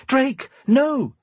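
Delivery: high-pitched urgent cry to stop him; shouted. A narrator's reading. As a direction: call out high in alarm, not at full volume. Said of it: "she shouted".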